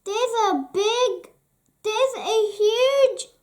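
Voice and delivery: high, rising urgency; breathless child, trying to tell; urgent excitement. Acted. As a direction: rush to tell dad. Daisy urgent, cut off.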